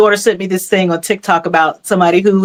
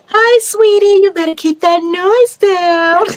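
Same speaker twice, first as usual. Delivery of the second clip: sweet warning; 10 semitones higher, much slower than usual. Pretend. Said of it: "I'd be like".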